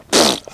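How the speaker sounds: short low lip buzz; baby's raspberry. Retold or imitated. Imitating the baby's last reply, short blown raspberry. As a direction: copy a baby's playful raspberry. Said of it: "looks at me and she goes".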